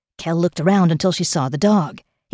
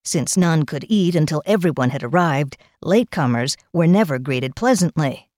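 ordinary delivery

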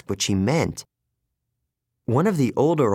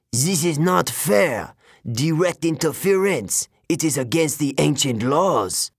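wailing monster; 4 semitones higher than usual. Acted. Manticore protesting to the hunters, a high wailing monster voice. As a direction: whine at the unfairness like a cornered beast. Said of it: "The monster wailed".

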